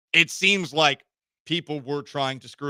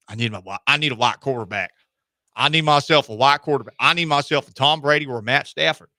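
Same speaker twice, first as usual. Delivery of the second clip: louder, lightly put-on twangy coach voice; a hint of a good-old-boy coach voice; put-on coach accent; 6 dB louder than usual. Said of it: "I gave him this accent".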